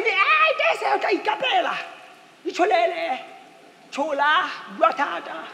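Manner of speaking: mocking a rude wife. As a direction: sneer it out like a sharp-tongued wife.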